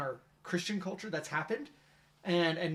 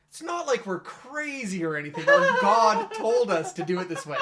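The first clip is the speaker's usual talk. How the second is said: higher, louder, breaking into laughter; raised voice bubbling with laughter; amused punchline quote; 6 semitones higher, 10 dB louder than usual. Retold or imitated. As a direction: quote the cartoon line, laughing through it. Retelling cartoon punchline, laughing.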